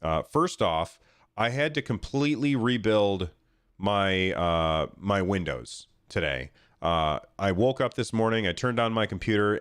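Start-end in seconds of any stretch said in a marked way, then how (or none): none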